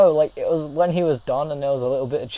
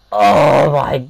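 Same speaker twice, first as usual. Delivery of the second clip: loud mock-shocked wail; shocked cry; 10 dB louder than usual. Reacting to friend's excuse, loud shocked cry. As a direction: cry out in scandalised disbelief.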